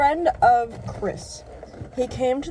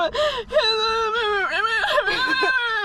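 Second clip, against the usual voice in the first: crying laugh; 10 semitones higher than usual. Retold or imitated. Sobbing laugh mid-story.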